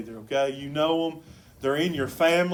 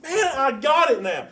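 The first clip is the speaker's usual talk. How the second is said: excited; 10 semitones higher, 8 dB louder than usual. Retold or imitated. Pastor finally grasps good news.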